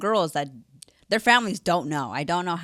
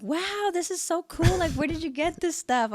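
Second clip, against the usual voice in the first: impressed mom; 11 semitones higher than usual. Retold or imitated. Mom admiring the free clothes, high-pitched mock delight.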